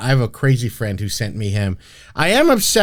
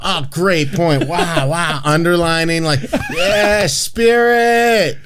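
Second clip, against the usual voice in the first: high, laughing, gushing over a sermon point; mock enthusiastic churchgoer; 6 semitones higher, much slower than usual. Friend mocking his old self taking sermon notes, gushing and high. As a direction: ham up an eager note-taker in the pew.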